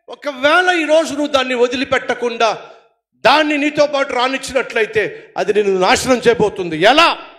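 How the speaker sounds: preaching with sudden loud bursts; forceful preaching. Acted. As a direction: exhort the congregation to give up sin. Preacher exhorts the congregation. (బలమైన ప్రసంగ శైలి)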